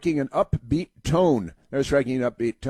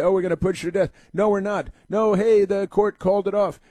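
higher, mock flustered official voice; 7 semitones higher than usual. Commentator mocking prison officials, high and flustered. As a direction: play the dithering officials, raised and jumpy. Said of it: "then they say".

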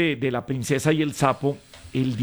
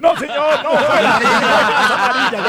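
loud, high mock outrage through laughter; laughing protest; 8 semitones higher, 8 dB louder, much slower than usual. Real, not acted. Co-host penalizes the rude joke, shouting in laughing protest. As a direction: shout a referee-like objection, stretched and laughing.

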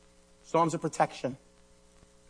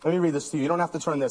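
ordinary delivery

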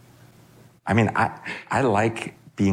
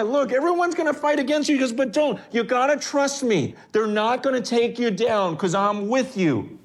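urgent reassurance; 10 semitones higher than usual. Retold or imitated. God reassuring Jeremiah.

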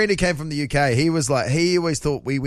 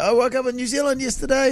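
excited shout; 8 semitones higher than usual. Retold or imitated. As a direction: shout it out with joy. Imitating Colbert's Emmy shout-out.

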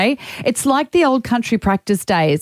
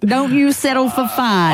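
mock Ted Lasso accent; 3 semitones higher, slower than usual. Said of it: "say it with his accent".

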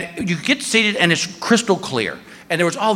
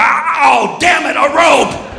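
higher, shouted cartoon shark foiled; a shark cursing the rope; frustrated shark; 8 semitones higher, slower than usual. Shark voiced in story.

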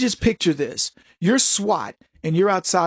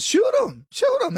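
much higher, flippant "Shoot 'em"; mock indifference to the hostage; careless dare. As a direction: shrug off the threat. Mocking hostage threat.

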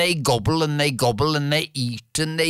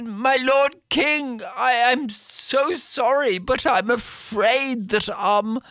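grovel to the king in a higher voice, hesitating; apologetic cook; 7 semitones higher than usual. Acted. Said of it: "walks in and says".

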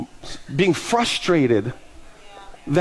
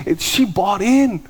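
amazed excitement; 8 semitones higher than usual. Retold or imitated. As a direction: marvel at it in a high, thrilled voice. Reenacts amazement that she bought in.

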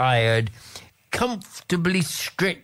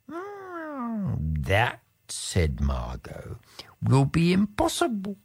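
sly, purring talking cat; 5 semitones higher, 5 dB quieter than usual. Acted. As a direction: coax like a clever cat. Cat character speaks.

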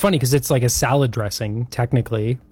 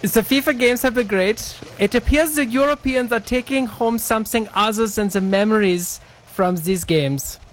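put-on German accent; 8 semitones higher than usual. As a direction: read it in a hammy German accent. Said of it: "in your German accent".

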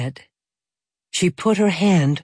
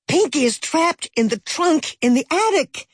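shouted; 11 semitones higher than usual. A narrator's reading. Girl shouting to the adults that her sister is trapped, frightened shout. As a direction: yell it to the whole kitchen. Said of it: "shouted Ferris".

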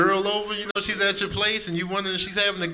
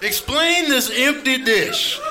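raised, demanding voice of a hungry cat; yelling, indignant cat; 4 semitones higher than usual. Acted. Hungry cat confronting its roommate over an empty dish, indignant. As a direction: scold the owner, high and forceful. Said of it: "cats are yelling at you when they're hungry".

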